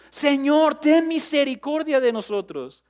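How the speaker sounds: high, loud desperate cry; desperate shout. Acted. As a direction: shout for mercy as if blind and pleading. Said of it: "Imagínate la desesperación del grito".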